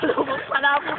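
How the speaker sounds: laughing through the words. Real, not acted. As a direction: chuckle it out. Laughing at himself.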